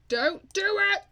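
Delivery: high, loud frantic shout; desperate shout of don't; panicked yell. Real, not acted. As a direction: yell at the screen to stop. Panicked yelling at a Sim.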